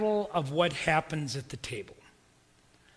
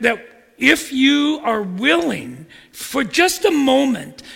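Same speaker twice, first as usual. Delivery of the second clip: louder, slower, earnest plea; earnest preaching; 4 semitones higher, 7 dB louder than usual. Acted. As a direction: urge them to stop and think. Preacher presses the point.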